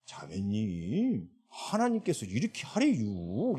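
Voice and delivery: put-on slow Chungcheong accent, endings dragged out; put-on Chungcheong lilt, unhurried; Chungcheong dialect. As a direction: imitate a drawling Chungcheong speaker. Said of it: "충청도 사투리를 갑자기 서울말 쓰던 자매가".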